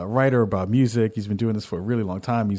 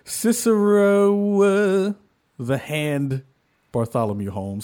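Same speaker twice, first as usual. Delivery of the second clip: stretched out long, high and louder, like a ring announcer; hype announcer; 7 semitones higher, 3 dB louder, slower than usual. Acted. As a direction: drag the name out for the crowd. Hype intro of co-host.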